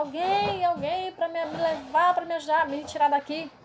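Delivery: mild retold desperate call; retold desperate cry. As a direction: cry out for someone to take you away. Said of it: "Aí eu gritava".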